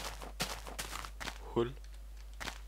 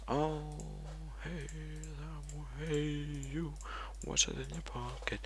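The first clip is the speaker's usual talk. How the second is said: cooing at a chicken; 9 semitones higher, 11 dB quieter, slower than usual. Pretend. Coos at a chicken.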